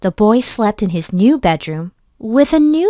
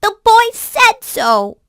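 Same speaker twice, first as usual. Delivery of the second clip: very high, loud, slow call; the toy rabbit calling out, hurt; upset call; 13 semitones higher, 5 dB louder than usual. Acted. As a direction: shout it after them, near tears.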